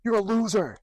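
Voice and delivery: retold insult. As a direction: imitate the trucker spitting the insult. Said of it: "And he goes".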